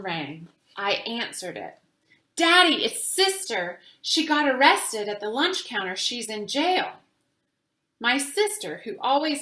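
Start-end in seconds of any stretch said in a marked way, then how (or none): 2.3-7.0 much higher and louder, urgent alarm; alarmed news. A narrator's reading. Girl shouting to Daddy that Sister was arrested, alarmed and loud. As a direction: blurt it out in a panic.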